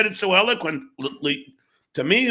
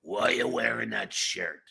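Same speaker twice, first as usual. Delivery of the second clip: put-on snarling drunk woman; 7 semitones lower, 8 dB quieter than usual. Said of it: "she goes, stink eye, snarl".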